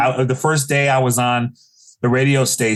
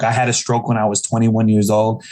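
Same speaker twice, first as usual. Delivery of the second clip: ordinary delivery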